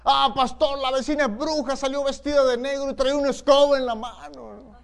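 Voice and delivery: high, loud, anxious tattling; frightened church sister complaining to the pastor; alarmed complaint. Retold or imitated. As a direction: panic that the neighbor is a witch. Fearful sister accuses neighbor of witchcraft.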